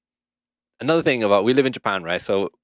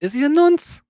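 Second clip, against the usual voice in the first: very high, louder disbelieving "Is he a nonce?"; incredulous; 6 dB louder than usual. Real, not acted. Echoing his friend's claim about Freddy in shock.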